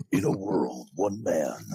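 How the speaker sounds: deep epic trailer narrator; low, booming trailer announcer; movie trailer voice. Shown as a demonstration. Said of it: "the epic movie trailer voice".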